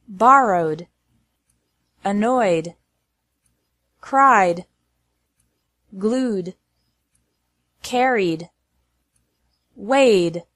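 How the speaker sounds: "Cried", "Glued" with a plain d after the vowel. Shown as a demonstration. Teacher drilling verbs ending in a vowel, ED as D. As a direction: model "Borrowed", "Weighed" singly, each closing on d. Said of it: "E D is pronounced like D".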